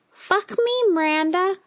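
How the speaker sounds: very high, loud parrot squawk. A narrator's reading. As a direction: screech it like a mimicking parrot. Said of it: "it squawked".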